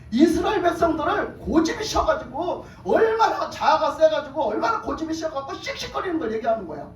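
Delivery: heated, high-pitched rant about stubborn people; carried away. Real, not acted. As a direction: get worked up without noticing, pitch climbing. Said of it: "나도 모르게 흥분해 버렸나 봐". (흥분해서 높은 목소리로 열변)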